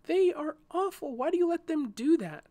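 high-pitched, appalled outcry; aghast, high all the way through; disturbed protest. A narrator's reading. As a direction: object in real dismay. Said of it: "Nigel was disturbed".

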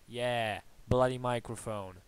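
ordinary delivery

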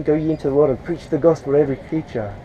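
ordinary delivery

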